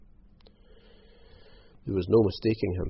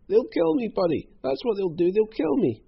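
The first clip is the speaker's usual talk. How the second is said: very high, terrified wail; panicked, sobbing plea; 13 semitones higher, 4 dB louder than usual. A narrator's reading. Croc clinging to Hal's legs, wailing in terror. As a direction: plead for your life, pitch way up. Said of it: "threw his arms around Hal's legs and sobbed".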